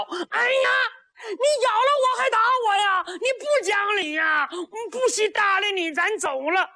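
a big simple lad sulking that he was wronged; whiny simpleton Niu Tong. A narrator's reading. Niu Tong whining at the girl who beat him, high and teary.

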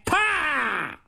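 sharp high clap noise on the table; mouth slap. A narrator's reading. Storyteller imitates the marshal slapping the table, sharp clap. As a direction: snap out a table slap sound. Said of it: "把桌案一拍".

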